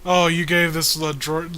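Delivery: normal talking voice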